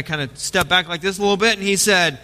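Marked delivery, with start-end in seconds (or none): none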